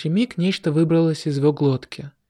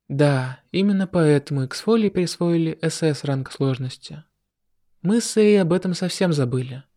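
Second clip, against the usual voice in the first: usual speaking voice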